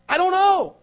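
high, loud, helpless I don't know; flustered cry. Acted. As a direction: blurt it out in a panic. Moses imagines answering the people.

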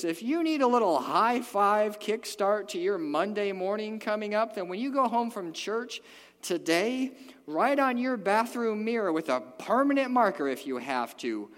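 loud, high preaching; rally the church loudly; full-force preaching. Acted.

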